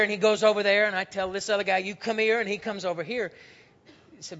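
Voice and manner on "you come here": higher, louder summons to a soldier; commanding order. Retold or imitated. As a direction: call him over like an officer. Centurion giving soldiers orders.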